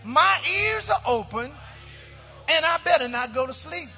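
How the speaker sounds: high, ringing chant-like declaration in short phrases; congregational declaration. Real, not acted. Pastor leading the church in its Bible confession, bold high proclamation. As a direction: proclaim it phrase by phrase for the crowd to repeat.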